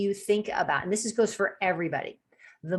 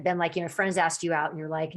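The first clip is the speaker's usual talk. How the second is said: normal talking voice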